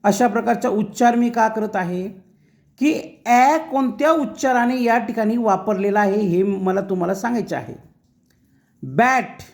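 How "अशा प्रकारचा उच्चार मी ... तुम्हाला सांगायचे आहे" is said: normal talking voice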